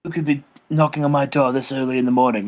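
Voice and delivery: lightly voiced English gentleman, low; a hint of puzzled English gentleman; Steven Regal. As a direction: wonder aloud with mild English propriety. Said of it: "And he's like".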